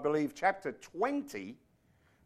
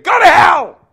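explosive, high-pitched angry yell; mad shout; 14 semitones higher, 20 dB louder than usual. Acted. Imagined prophet Samuel losing his temper, mad shout. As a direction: bellow it at full force, acting enraged. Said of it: "if he got mad and said".

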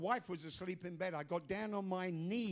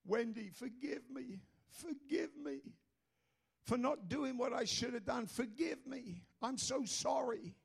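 pleading apology; 9 semitones higher, slower than usual. Retold or imitated. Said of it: "what I said to her".

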